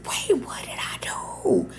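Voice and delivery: whispered, startled and worried; scared whisper. Retold or imitated. Summoned to the manager's office, a scared whisper. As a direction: hush it like you're caught out. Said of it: "And I'm like".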